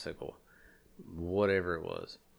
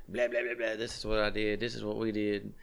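mocking chatter; 3 semitones higher than usual.

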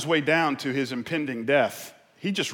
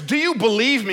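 loud, raised call from above; booming voice from heaven; 11 semitones higher, 9 dB louder than usual. Acted. Voice from heaven questions the man, calling out. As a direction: play God testing a man, loudly. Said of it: "Voice says".

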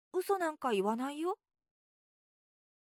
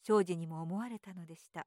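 ordinary delivery

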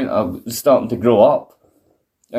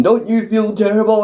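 high mock interviewer question; Mean Gene voice; 10 semitones higher than usual. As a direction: ham up the interviewer. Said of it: "fucking Mean Gene".

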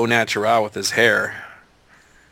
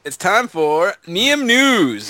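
announcer voice; 8 semitones higher, much slower than usual. Acted. Announces news segment.

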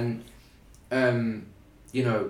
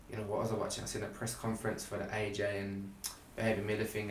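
ordinary talk, nothing marked

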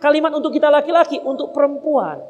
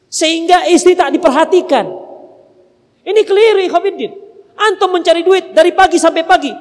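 raised, driving sermon voice; fiery preaching; 5 semitones higher than usual. Acted. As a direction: preach it hard to the brothers. Keras menasihati para suami. (berkhotbah dengan suara lantang dan berapi-api)

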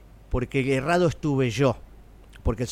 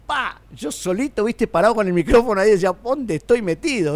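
higher, louder acted astonishment; overwhelmed, marvelling at where you've landed; retold amazement; 5 semitones higher, 5 dB louder than usual. Performer recalling huge crowds in Bolivia, mock-stunned exclamation. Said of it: "yo decía".

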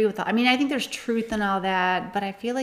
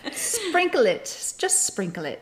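put-on British accent; 6 semitones higher, slower than usual. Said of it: "we're terrible at accents".

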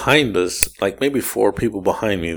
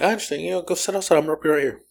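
higher, softer, gentle reassurance; reassuring Emily as her anxiety kicks in, gentle; 8 semitones higher, 7 dB quieter, faster than usual. Retold or imitated. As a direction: soothe someone whose anxiety is kicking in. Comforting anxious Emily.

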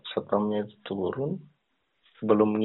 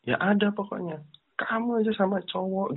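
put-on higher, accusing boyfriend; 11 semitones higher than usual. Boyfriend accusing girlfriend in the hotel lobby, higher. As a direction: throw it back at her, higher.

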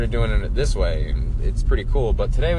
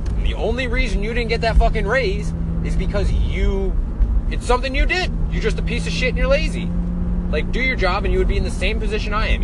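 venting at the lazy coworkers, pitched way up; angry rant; 10 semitones higher than usual. Real, not acted. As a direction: tell them off, heated and high.

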